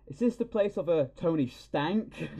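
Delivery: put-on high, slow, creaky old man. Stan Lee as delivery guy, old man voice. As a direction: put on a doddering old courier. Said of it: "And he goes".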